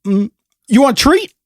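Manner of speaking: high cooing pet voice, rising; talking to a dog. Retold or imitated. Neighbor offering the dog a treat in high baby talk. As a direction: offer it sweetly like to a puppy. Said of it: "And he goes".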